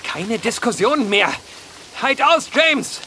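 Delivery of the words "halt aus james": higher, urgent shout of encouragement; calling out. Acted. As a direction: yell it so he hears. Calling to a man hanging.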